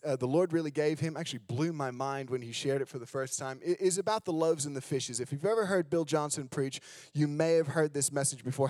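ordinary talk, nothing marked

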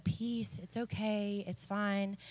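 soft, gentle bedside reassurance; calm an anxious patient in a hushed voice; quiet, tender comforting; soothing. Retold or imitated. Retells calming an unconscious patient.

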